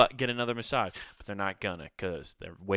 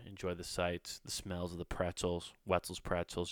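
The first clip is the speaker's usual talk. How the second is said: ordinary delivery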